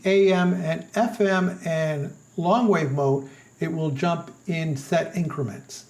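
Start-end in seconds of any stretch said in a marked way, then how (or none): none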